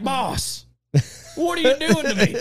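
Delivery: high, loud mock pleading; imitated exasperated manager. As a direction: plead with the boss in an exaggerated high voice. Road manager's exasperated plea, voiced.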